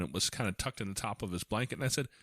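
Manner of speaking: ordinary talk, nothing marked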